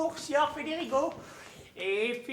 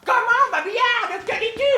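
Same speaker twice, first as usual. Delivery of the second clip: indignant; 7 semitones higher, 9 dB louder than usual. Acted. Father scolds daughter.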